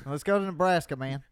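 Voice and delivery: hammed-up rallying call; mock enthusiasm. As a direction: play the over-keen Texan, loud. Hammed-up follow-on to Texan bit.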